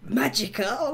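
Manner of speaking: high, surprised American-y Magica; surprised question. Retold or imitated. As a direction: ask in disbelief. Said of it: "she's like".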